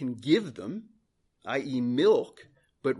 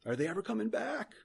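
high, soft anxious question; worried child's thought; 8 semitones higher, 6 dB quieter than usual. Acted. Preacher voicing an abandoned baby's thoughts, high soft worry. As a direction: wonder softly, pitched high, as if left alone.

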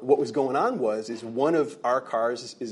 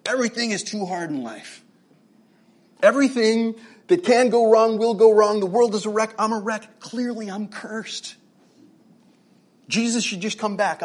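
high, hammed-up cry of despair; exasperated rant; 8 semitones higher, 6 dB louder than usual. Man having a terrible day rants to himself, melodramatically exasperated. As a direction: rail at the world in mock misery.

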